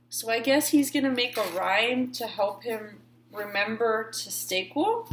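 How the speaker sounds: ordinary delivery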